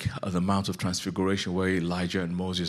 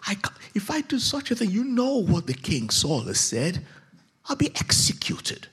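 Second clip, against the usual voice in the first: frightened witch; 11 semitones higher than usual. Acted. Fearful witch pleading with Saul.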